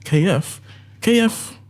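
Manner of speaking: mock bewildered double take; confused surprise. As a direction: pitch climbing in disbelief. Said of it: "in my head, I was like".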